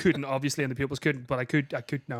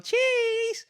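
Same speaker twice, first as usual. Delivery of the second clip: high sung-out photo cheese; posing for a photo. Retold or imitated.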